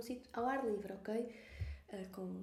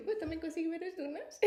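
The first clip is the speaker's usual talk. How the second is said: higher, puzzled echo of her words; 5 semitones higher than usual. Retold or imitated. Replying to the crying woman, in a higher puzzled echo. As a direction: echo her back, baffled and a bit higher. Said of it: "Tá, tipo".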